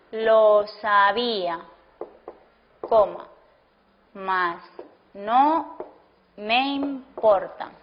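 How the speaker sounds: word by word, with long gaps between words; slow dictation. Shown as a demonstration. Teacher to class, dictating an example sentence slowly. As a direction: dictate it slowly so students can write.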